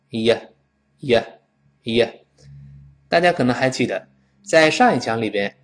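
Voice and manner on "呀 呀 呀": "呀" as a short, weak ye; clipped schwa version of you, "呀"; reduced "you". Shown as a demonstration. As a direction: toss off each "呀" briefly, unstressed. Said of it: "所以读成".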